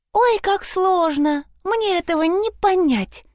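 high, slow sing-song mockery; mocking. Nora mocking Sprotte's ghost hunt, high and slow. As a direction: drawl it out in a high teasing voice.

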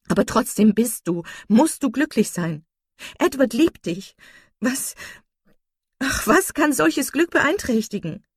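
high, loud, fervent insistence; impassioned. A narrator's reading. Marian insists her sister must be happy, impassioned.

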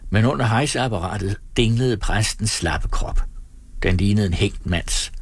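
ordinary delivery, nothing marked

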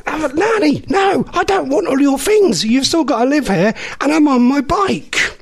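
high-pitched, drawn-out pleading refusal; exasperated protest. Retold or imitated. Grandchild refusing nanny's belongings, high exasperated protest. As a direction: plead with them to stop, voice pitched right up.

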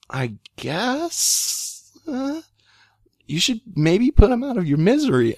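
imitated reluctant answer, high and halting; hesitant reply. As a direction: draw it out unsurely, voice climbing high. Acting out sister's reluctant answer.

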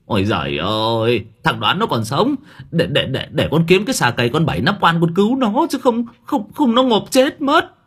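flustered and rising high; panicked. A narrator's reading. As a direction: stammer in a panic. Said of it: "Ông Hải liền cuống quýt".

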